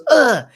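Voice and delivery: loud, high grunt of impact; imitated pained grunt. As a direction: mimic a kid getting smacked. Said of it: "So he goes".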